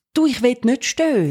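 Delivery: apologetic little mom voice; imitating mom. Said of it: "was typisch Mami ist".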